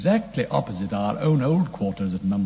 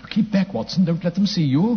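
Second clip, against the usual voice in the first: urgent warning; 7 semitones higher, 6 dB louder than usual. Acted.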